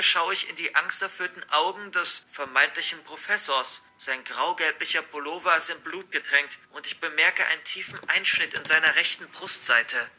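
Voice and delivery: plain reading voice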